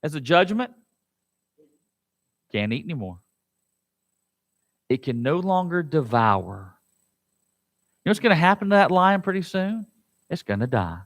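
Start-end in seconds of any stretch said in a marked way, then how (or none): 2.5-6.8 much softer and lower; hushed. Real, not acted. Preacher answering his congregation, hushed. 10.3-11.0 very low, soft, dark; ominous hush. Real, not acted. Preacher telling congregation the lion's fate, hushed.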